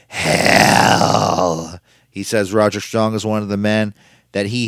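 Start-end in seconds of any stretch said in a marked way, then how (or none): 0.1-1.8 loud, drawn-out hammed-up proclamation; theatrical quote. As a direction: imitate a showman milking one word. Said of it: "and I quote".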